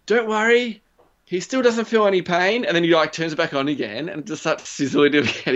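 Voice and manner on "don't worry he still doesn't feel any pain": high mock-cheerful showman voice; reassuring inventor. Imitating the inventor reassuring the crowd, high mock cheer. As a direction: sell it brightly despite the screams. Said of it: "and he's like".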